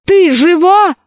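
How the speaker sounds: high, excited called question; boy shouting to his friend on the run; calling out. A narrator's reading. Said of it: "кричал он на бегу".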